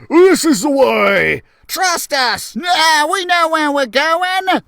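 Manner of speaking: loud, high, confident shouting; insistent shout. Acted. Mice Kings insisting they know the way, loud shout. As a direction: shout them down, sure of yourselves.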